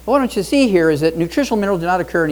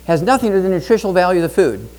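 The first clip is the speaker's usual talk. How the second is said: normal talking voice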